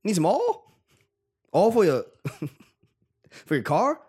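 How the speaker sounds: high hammed-up Southern drawl; southern accent. Shown as a demonstration. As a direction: ham up a Southern twang. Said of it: "I was trying to do a southern shit".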